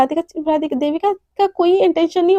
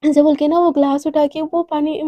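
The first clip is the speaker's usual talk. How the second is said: usual speaking voice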